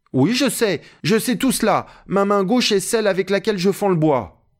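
very high-pitched eager protest; Jupiter, indignant servant. Acted. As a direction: insist you know, voice pitched right up. Narrator voices Jupiter protesting. (Jupiter, protestation aiguë)

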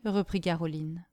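ordinary delivery

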